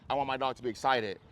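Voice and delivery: normal talking voice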